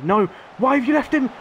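high, loud despairing complaint; real frustrated yell at the defender; exasperated yell. Real, not acted. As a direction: shout at the defender who left him. Defender leaves man free.

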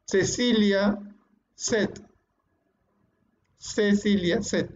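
Cecilia said with s sounds, as sesilia; teacher showing the c in Cecilia sounds like s; seseo demo. Shown as a demonstration. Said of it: "Lo pronunciamos igual".

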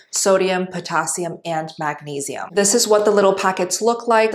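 upbeat product plug for the listeners; sponsor ad read. Acted. Host reads an electrolyte ad to new listeners, upbeat plug. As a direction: read the ad like a host endorsing a brand.

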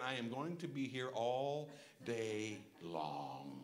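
very slow, low, drawn out; resigned, plodding grumble; weary impatience. Acted. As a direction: drag it out as if the wait will never end. Acting out weary wait at pump.

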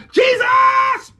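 very high, drawn-out pleading yell; screaming. Acted. Blind beggar begging Jesus for mercy, screaming. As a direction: scream to be noticed over the crowd. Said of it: "He's screaming, yelling".

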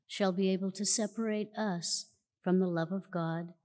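ordinary delivery

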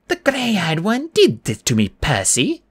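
put-on slow, high poor old grandmother; Medusa. A narrator's reading. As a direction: invite sympathy as a wronged old woman. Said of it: "Her voice invited me".

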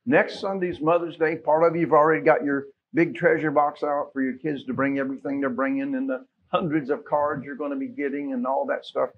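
normal talking voice

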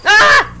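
sudden loud yelp of fright; startled scream. Real, not acted. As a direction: jump out of your skin. Startled by jump scare.

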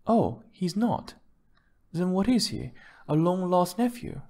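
high-pitched mocking questions; needling female character, pitched well up; sarcastic wife. A narrator's reading.

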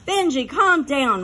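usual speaking voice

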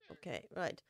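normal talking voice

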